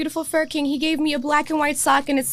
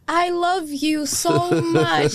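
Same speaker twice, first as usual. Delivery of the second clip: high, slow, tearful-happy laughter; overwhelmed with emotion; 4 semitones higher than usual. Real, not acted. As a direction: gush slowly, laughing through happy tears. Overwhelmed thanks to gift giver.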